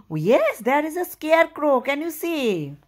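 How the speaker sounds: high excited gasp; gasp, then cry out in delight. Real, not acted. Parent reacts excitedly for the child.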